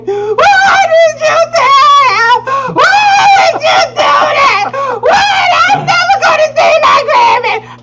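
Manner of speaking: high, loud wailing sobs; screaming crying child. Retold or imitated. Daughter screaming and crying at her mother. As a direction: wail it like a heartbroken kid.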